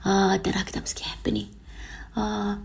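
whispered, dazzled and lovestruck; dreamy whisper. Retold or imitated. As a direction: breathe it like someone blind in love. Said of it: "وانت تخزر هكذا". (همس حالم مسحور بالحب)

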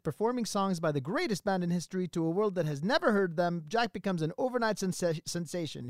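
rushed breathless synopsis and lyrics; all in one breath. Pretend. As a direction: rattle it all off without breathing. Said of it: "in one breath".

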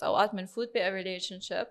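ordinary delivery

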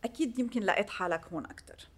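normal talking voice

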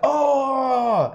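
loud high hammed-up "Oh!" of a shot soap actor; a TV death cry, overplayed and loud; mock dramatic cry.

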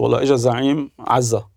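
normal talking voice

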